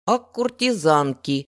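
куртизанки said slowly and loudly, each syllable spelled out; carefully pronounced word. A narrator's reading. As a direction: carefully sound out куртизанки, slow and deliberate. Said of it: "старательно выговаривая это слово".

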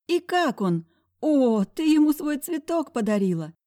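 high, loud teasing friend; teasing girlfriend. Acted. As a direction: tease her playfully.

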